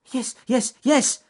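high, loud and eager; excited shout. A narrator's reading. Ernest answering the panicked mouse, high excited shout. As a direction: shout it back, all worked up. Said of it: "shouted Ernest Fluffy Rabbit".

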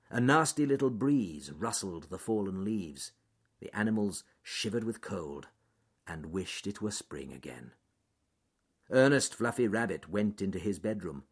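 usual speaking voice

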